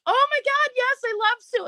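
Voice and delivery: high, squealing delight; gushing excitement. Retold or imitated. Herself telling the shopper she loves Sue, squealing excitedly. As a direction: gush like you just found a kindred spirit. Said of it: "So then I was like".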